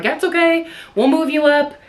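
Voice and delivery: mock nurse. Nurse voiced reassuring her.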